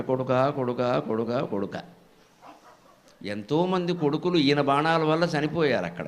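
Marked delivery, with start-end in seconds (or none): 0.0-1.8 mock wailing. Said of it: "ఏరుస్తున్నట్టు".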